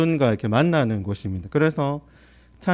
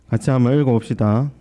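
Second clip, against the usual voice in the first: usual speaking voice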